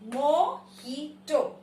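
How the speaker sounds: "mojito" very slow, mo - HEE - to; correct, by syllables. Shown as a demonstration. Teacher breaking up the word, slowly pronounced by syllable. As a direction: stretch out "mojito" with an H sound. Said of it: "it is mojito".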